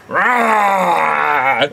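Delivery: short shouted beast roar; monster roar. Pretend. Sketch monster roars at the visitors, shouted roar.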